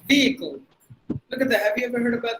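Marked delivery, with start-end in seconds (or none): 0.1-0.6 Vehicle with a long VEE; long sounds. Shown as a demonstration. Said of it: "two long sounds".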